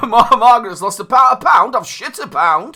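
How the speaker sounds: high, loud comic northern character; Peter Kay impression. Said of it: "Do your impression".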